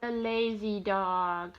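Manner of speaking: slow, lazy reading; lazy drawn out. Acted. Grandma reading to grandson, slow and lazy. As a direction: stretch it out like a sleepy dog.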